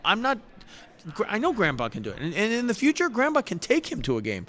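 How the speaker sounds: loud, high insistent protest; insistent dad raising his voice. Retold or imitated. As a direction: push back hard, voice up. Heated retort to family's suggestion.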